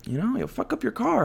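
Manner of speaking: mimicking girlfriend. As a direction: imitate the girlfriend warning him. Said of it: "And she was like".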